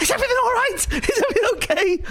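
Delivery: high, loud anxious calling; mimicking Juana's worried high call; worried woman. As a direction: voice her fretting from upstairs.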